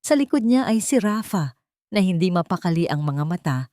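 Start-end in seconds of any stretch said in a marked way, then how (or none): none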